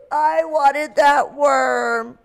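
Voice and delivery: slow, high, sobbing whine; crying whine. Acted. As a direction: wail it like a crying little bird. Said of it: "And he would cry".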